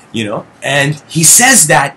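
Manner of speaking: ordinary talk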